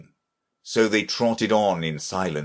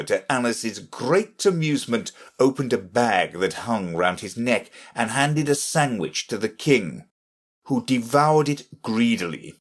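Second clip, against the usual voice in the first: ordinary delivery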